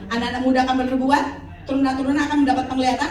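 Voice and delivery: normal talking voice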